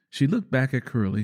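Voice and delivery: usual speaking voice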